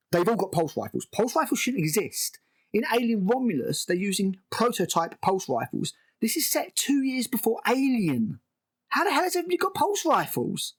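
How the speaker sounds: incredulous, pitch climbing high by the end; exasperated rant. Real, not acted. Reviewer fuming at pulse rifles in the timeline, exasperated. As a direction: build to a baffled, high-pitched outburst. Ranting about a plot hole.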